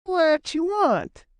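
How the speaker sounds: slow, high sleepy drawl. A narrator's reading. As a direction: answer slowly as if just woken. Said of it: "asked a sleepy voice".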